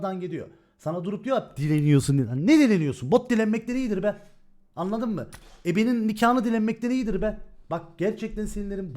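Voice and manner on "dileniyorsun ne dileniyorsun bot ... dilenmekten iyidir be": loud, scornful rebuttal; angry retort. Real, not acted. Streamer answering people who say he's begging, loud and scornful. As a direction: bark it back, indignant and loud.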